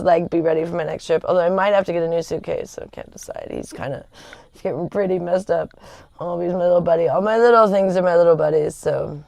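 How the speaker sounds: ordinary delivery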